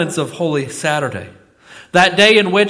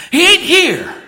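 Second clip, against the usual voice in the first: much higher, loud East Tennessee drawl; Don's East Tennessee drawl, even higher; 13 semitones higher, 6 dB louder than usual. Retold or imitated. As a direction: ring it out again in East Tennessee drawl, climbing way up. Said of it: "Don said".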